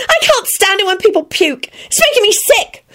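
very high squealed disgust; disgusted shout. A narrator's reading. Rosie flees Neville being sick, disgusted shout. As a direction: cry out high in revulsion. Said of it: "she shouted".

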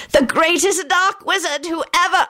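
high, boastful villain cry; triumphant scream. A narrator's reading. Scully boasts and gets interrupted, screaming triumphantly. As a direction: boast it high until cut off. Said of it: "Scully screamed out triumphantly".

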